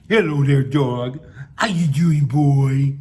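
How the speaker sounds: loud, playful pet-greeting voice; baby talk to a dog. Acted. Passerby smiling at a dog, loud baby talk. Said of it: "employ baby talk to talk to animals".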